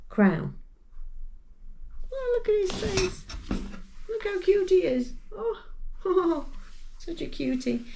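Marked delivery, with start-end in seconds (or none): 2.1-7.8 doting high coo over his little face. Real, not acted. Cooing over the crochet frog.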